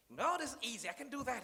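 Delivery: cocky imitation of a new boss; mock Donald Trump. Speaker mocking Trump starting the job, light boastful impression. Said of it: "when him come into the work".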